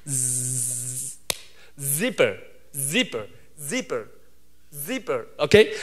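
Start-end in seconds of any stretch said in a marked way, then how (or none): 0.1-1.2 "즈" as a long buzzing English z; soft, sustained z buzz on "즈"; z sound. Shown as a demonstration. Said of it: "즈 발음이잖아요". 1.8-4.1 "zipper" with a buzzing initial z; soft, low teacher's model of "zipper"; "zipper" said singly, z held; z sound demo. Shown as a demonstration. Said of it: "즈 발음이잖아요".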